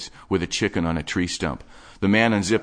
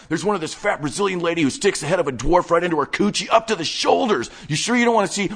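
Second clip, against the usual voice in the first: higher, fast-talking obsessive geek; breathless nerdy enthusiast showing off his stash; manic collector Wade; 8 semitones higher, 3 dB louder than usual. Acted. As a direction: rattle it off like an excited hoarder. Wade again.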